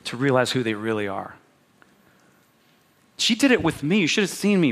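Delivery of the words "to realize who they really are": fond scheming thought. Retold or imitated. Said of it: "thinking like".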